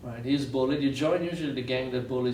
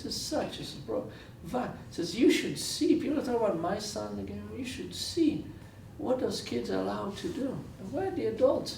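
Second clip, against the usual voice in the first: imitating a father's broken English, pitched higher and pleading; 9 semitones higher, 3 dB quieter than usual. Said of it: "he says, in broken English".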